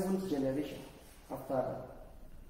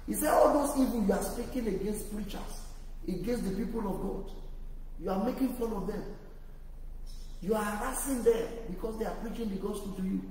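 louder, insistent preacher warning; forceful preaching; 4 semitones higher, 3 dB louder than usual. Acted. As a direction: hammer the warning home to the congregation. Preaching judgment.

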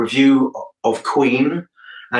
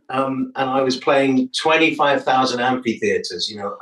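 ordinary talk, nothing marked

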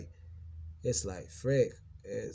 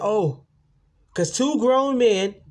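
very high, loud mocking voice, slow; mocking quote; 11 semitones higher, 10 dB louder, much slower than usual. Mimicking front desk staff who mocked him, shrill. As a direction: screech it like a mocking coworker. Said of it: "And they said that".

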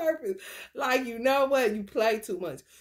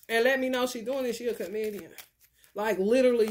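ordinary talk

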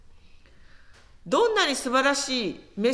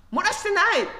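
put-on child's protest; 8 semitones higher, faster than usual. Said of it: "まあ、子供ですから".

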